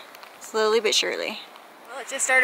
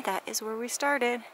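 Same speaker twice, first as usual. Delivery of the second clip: normal talking voice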